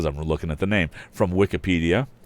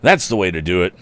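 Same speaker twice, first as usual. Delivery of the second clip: lively, louder read-aloud storytelling; story reading; 3 semitones higher, 8 dB louder than usual. A narrator's reading. Narrator reading an old boys' adventure to listeners, lively.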